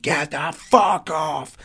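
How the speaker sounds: slow, growled-out mock fury; imitating an angry, menacing snap; retold angry outburst. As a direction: drag each word out in mimicked rage. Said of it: "my tone in my voice".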